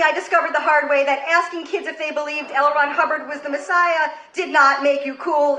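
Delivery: normal talking voice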